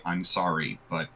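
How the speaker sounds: low, soft, halting apology; subdued, slightly guilty admission; sheepish apology. Acted. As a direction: apologise sheepishly, slowly and quietly. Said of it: "kind of sheepishly say".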